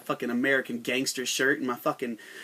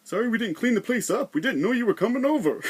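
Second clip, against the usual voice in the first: higher, apologetic mock Canadian voice; meek Canadian; 6 semitones higher, 4 dB louder than usual. Mocking Canadians apologizing to invaders, higher and meek. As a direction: play a flustered host apologizing. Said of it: "they'll be like".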